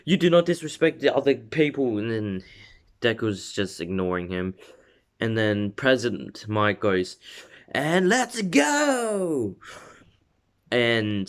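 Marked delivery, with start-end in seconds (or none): 0.1-2.0 a hint of a strict class rep, higher and scolding; lightly voiced prim scolding; Ida lecture. Rule-stickler Ida scolds Deku, lightly voiced stern scold. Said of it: "he starts doing his Ida thing". 7.7-9.6 much higher, slow drawn-out hype call; hyped announcer. Retold or imitated. President Mike kicks off the exam, high drawn-out announcer call.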